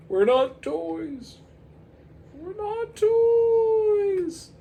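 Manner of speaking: high, very slow, loud spooky voice; creepy toys droning in unison; eerie toy chorus. Acted.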